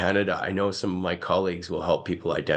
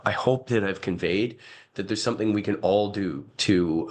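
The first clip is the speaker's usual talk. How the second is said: ordinary delivery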